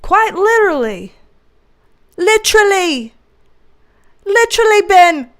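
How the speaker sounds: loud, high, insistent "Literally"; emphatic repetition. Real, not acted. Hammering the joke home.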